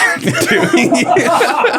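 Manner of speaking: high, cracking laugh; can't hold the laugh in; helpless laughing fit; real burst of laughter. Real, not acted. Recalling the butt tattoo.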